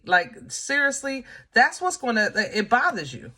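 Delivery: ordinary talk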